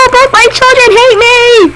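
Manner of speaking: put-on mother wailing about her children; lament. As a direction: wail that both children hate you.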